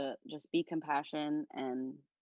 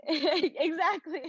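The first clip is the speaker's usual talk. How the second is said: a high, slow laugh through tears; real choked-up laugh on the word; teary laugh; 13 semitones higher, 5 dB louder, much slower than usual. Real, not acted. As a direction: laugh it out while close to crying. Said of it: "It's okay to cry".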